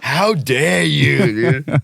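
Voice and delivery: mimicked soundbite catchphrase. Podcaster echoing the activist's soundbite, drawn-out mock scolding. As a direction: draw it out like a scolding speech. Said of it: "if we have a soundbite of".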